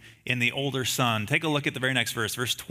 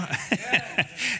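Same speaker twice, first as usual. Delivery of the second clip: a brief amused chuckle; quick real laugh; short laugh; 6 semitones higher than usual. Real, not acted. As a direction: laugh lightly at the silly plot. Laughs about movie plot.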